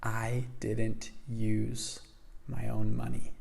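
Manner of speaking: very slow, hushed retold secret; confidential reveal. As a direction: lean in and measure out each word softly. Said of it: "he kinda leans in".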